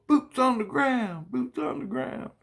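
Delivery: very high, chanting mock child voice; imitating kids. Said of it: "my kids go".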